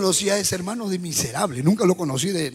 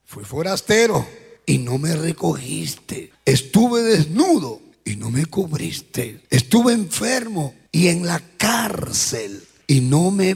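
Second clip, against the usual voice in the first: solemn scripture reading; 5 semitones lower, slower than usual. Acted. Reading the Lord's words slowly.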